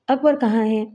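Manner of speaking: ordinary delivery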